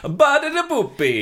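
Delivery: very high, loud Italian squeal; over-eager cartoon Italian; mock Italian accent. Pupil repeating after teacher, squealed Italian accent.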